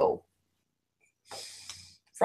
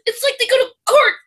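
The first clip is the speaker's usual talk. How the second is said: high, louder outraged husband; indignant rant; 14 semitones higher, 4 dB louder than usual. Retold or imitated. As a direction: rant like the show wronged him personally. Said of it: "He goes".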